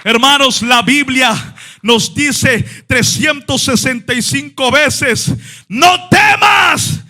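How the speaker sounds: full-force preacher's litany; preaching. Acted. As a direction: hammer each day's promise.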